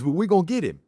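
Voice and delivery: high, lightly drawled Cajun cousin voice; imitating cousin. Cousin promising to catch the gator, high imitated voice.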